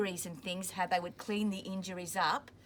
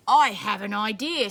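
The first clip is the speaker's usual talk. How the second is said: bright, louder "I have an idea"; Simpson's idea; 9 dB louder than usual. Acted. Simpson tells the soldiers his idea, eager and louder. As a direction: announce the plan. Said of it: "said Simpson".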